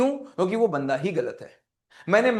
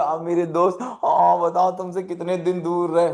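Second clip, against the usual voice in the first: gushing friend; 4 dB louder than usual. Retold or imitated.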